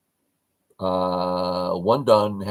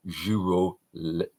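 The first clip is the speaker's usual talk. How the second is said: "girolet" said with a bad French accent, soft zh start; a clumsy French try at "girolet"; 3 semitones lower, 5 dB quieter, slower than usual. Shown as a demonstration. Teacher guessing a viewer's French name, bad French accent. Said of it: "My French accent is horrible".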